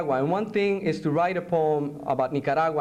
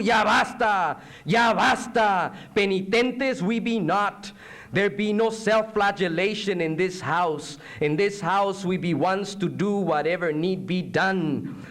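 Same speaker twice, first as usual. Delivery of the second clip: high, ringing poetic defiance; defiant declamation; 4 semitones higher, slower than usual. Acted. Poet voicing those who refuse to give in, high and defiant. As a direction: proclaim refusal like a rallying speech.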